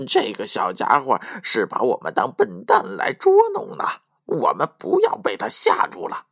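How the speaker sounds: lightly voiced thief rallying his partner; a touch of bravado in a put-on thief voice; plucked-up courage. A narrator's reading. Thieves daring each other to go back, mustered courage. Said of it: "又鼓起了勇气说道".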